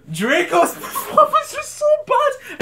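high, loud squeal through laughter; real laughter, voice shooting up high; laughing hard. Real, not acted. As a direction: crack up while getting the words out. Cracking up at Draco's acting.

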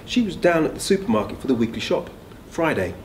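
usual speaking voice

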